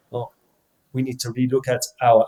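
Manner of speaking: light imitation of a French accent; a hint of a French manager's accent. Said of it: "did the French accent".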